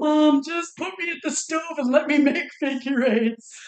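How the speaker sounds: lightly voiced pleading child; a hint of a kid begging mom; whiny kid voice. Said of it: "kid pleading with mom".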